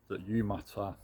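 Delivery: ordinary talk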